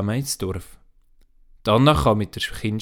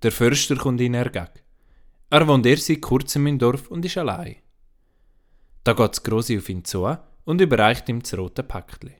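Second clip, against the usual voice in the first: ordinary delivery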